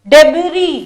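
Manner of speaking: usual speaking voice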